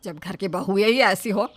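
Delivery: mother-in-law mocking her daughters-in-law; loud taunt. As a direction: sneer at the bahus.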